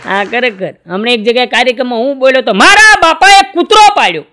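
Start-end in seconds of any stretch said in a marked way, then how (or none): 2.5-4.2 high and loud, announced to the crowd; loud blurted line. Retold or imitated. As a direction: belt it out to the audience. (ઊંચા અવાજે જોરથી બોલેલું)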